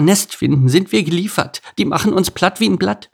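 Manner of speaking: high, squeaky little ant voice; small creatures fretting in a high pitch; worried ants. Acted. Ants fearing they will be flattened, high and worried.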